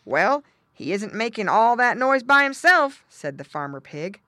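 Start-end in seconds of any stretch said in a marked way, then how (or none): 0.0-3.0 louder, raised father pig reply. A narrator's reading. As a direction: answer your wife emphatically. Father pig figure answers loudly.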